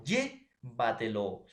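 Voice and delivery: llévatelo with LLÉ stretched and lifted; stress on LLÉ. Shown as a demonstration. Teacher giving sobresdrújula examples, stressing LLÉ in llévatelo. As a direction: hit the first syllable of llévatelo, then drop. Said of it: "como palabras sobresdrújulas como".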